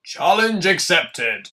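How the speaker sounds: loud, high, drawn-out proclamation; hammed-up battle cry; bold declaration. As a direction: announce it like a hero taking on a quest. Declares he takes the request.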